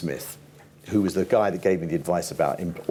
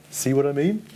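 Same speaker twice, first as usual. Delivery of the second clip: higher, laughing see what I mean; amused aside; 8 semitones higher than usual. Retold or imitated. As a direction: share the joke about the crowd. Gene showing the crowd outside.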